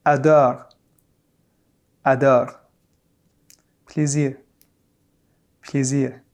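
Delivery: adore and plaisir with a faint French r at the end; subtle final r. Shown as a demonstration. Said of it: "it's more subtle".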